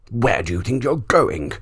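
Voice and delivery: loud, menacing lion demand; growling lion. A narrator's reading. Lion blocks the boy's path, loud and menacing. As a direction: loom over him as the lion.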